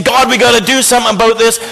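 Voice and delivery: high, hurried plea, all pent up; frustrated, concerned. Acted. Habakkuk urges God to act, pent up. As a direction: act pent-up alarm, pitched high and fast. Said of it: "he's getting all concerned".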